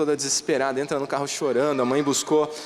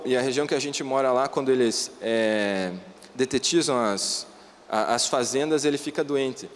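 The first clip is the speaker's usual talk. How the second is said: ordinary talk, nothing marked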